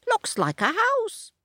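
high rooster voice; Cockerel. A narrator's reading. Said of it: "says Cockerel".

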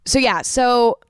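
normal talking voice

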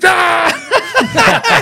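very high, loud laughing scream; shriek of laughing dismay. Retold or imitated. Sister hearing her brother has a girl, laughing shriek. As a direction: shriek like the joke is on you. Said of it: "She's like".

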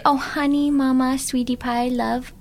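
put-on sweet little-boy coo, lifted high; imitating her toddler son. Mother imitating her little son's sweet talk, lightly cooed. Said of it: "He literally calls me honey".

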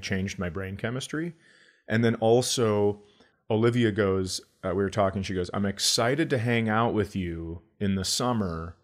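normal talking voice

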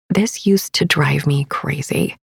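ordinary delivery

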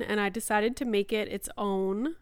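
usual speaking voice

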